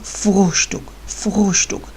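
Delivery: फोश्टुक with an overdone gargled r, the second almost whispered; exaggerated throaty r. Shown as a demonstration. Teacher shows the wrong overdone throaty r. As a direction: swallow the r in फोश्टुक too hard, then whisper it. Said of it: "अब इसका मतलब ये नहीं कि ये फोश्टुक है".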